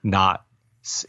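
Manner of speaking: usual speaking voice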